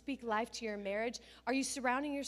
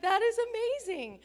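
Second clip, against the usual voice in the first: louder, higher burst of praise; delighted mom; 11 semitones higher, 7 dB louder, faster than usual. Retold or imitated. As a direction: gush with delight. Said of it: "I'm like".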